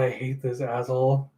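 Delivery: ordinary delivery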